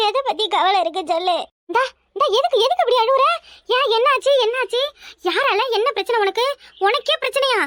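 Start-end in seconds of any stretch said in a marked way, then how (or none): 1.7-3.2 startled, worried friend; alarmed concern. Acted. 3.8-4.3 higher and louder, urgent what happened; worried friend demanding an answer; alarmed asking. Acted. 4.4-7.6 startled, worried friend; alarmed concern. Acted.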